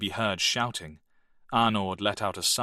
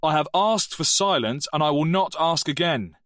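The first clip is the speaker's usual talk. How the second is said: a louder, higher angry outburst; angry teacher; 7 semitones higher, 5 dB louder than usual. Acted.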